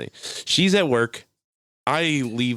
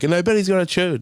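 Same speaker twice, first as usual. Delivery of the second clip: loud, raised punchline voice; mock joke tag; 8 semitones higher, 5 dB louder than usual. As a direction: deliver the closing tag louder and higher.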